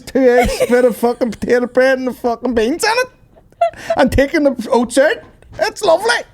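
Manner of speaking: very high, incredulous comic pleading; hammed-up high voice of an over-keen food pusher; mock porridge enthusiast. Pushing ever worse porridge recipes, in high mock disbelief. Escalating porridge defender bit.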